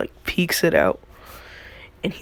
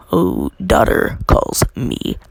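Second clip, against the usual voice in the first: cocky smooth talker; 7 dB louder, slower than usual. Retold or imitated. Kid suddenly turns from sweet to swaggering, cocky. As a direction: draw it out with cocky, put-on bravado.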